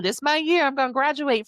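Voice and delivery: mock confident promise. Mocking the reader's yearly promise.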